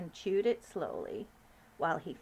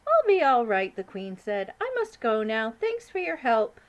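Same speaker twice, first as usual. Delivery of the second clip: high, regal, louder queen voice; 4 semitones higher, 9 dB louder than usual. Acted. Queen calling up to the giant, regal and high. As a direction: speak as a commanding queen.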